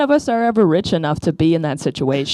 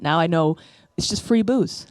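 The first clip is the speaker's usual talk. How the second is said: ordinary talk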